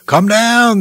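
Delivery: a high, loud jeer; mock him from below the cross; taunting. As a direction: soldiers taunting the crucified man. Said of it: "Roman soldiers taunted him".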